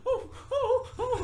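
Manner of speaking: high whimpering dog voice through a laugh; squeaky high little oh oh oh, laughing. Retold or imitated. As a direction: whimper like a dog rolling over. Voicing the submissive dog.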